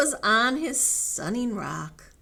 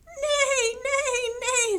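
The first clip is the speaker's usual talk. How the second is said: very high, loud little neighs; whinny in a tiny high voice; neighing chicks; 5 dB louder, slower than usual. Acted. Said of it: "from the chicks".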